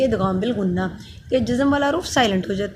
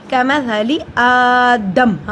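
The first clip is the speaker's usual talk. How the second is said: slow, louder recited word; recited demo word; 5 dB louder, much slower than usual. Shown as a demonstration. Teacher models the word for students in slow chant. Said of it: "حمزہ پہ کھڑی زبر ایک الف کے برابر لمبا ہوگا".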